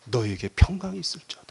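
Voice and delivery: hushed, low, tender blessing; almost whisper it, gently and kindly; quiet, breath-soft reassurance; soft, warm voice. Acted. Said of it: "얼마나 부드럽고 따뜻한 음성".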